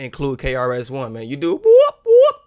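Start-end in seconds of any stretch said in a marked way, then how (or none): 1.3-2.4 loud, high siren-like whoop whoop; police siren whoop. Retold or imitated. Hyping the song to his viewers, a loud mock police siren. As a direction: imitate a police siren, rising. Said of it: "sound it up, police".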